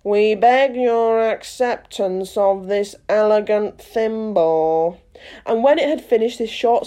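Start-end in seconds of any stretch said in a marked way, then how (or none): 0.0-5.0 slow, ceremonious speech; solemn. A narrator's reading. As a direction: present it with mock gravity. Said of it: "solemnly presented the thimble".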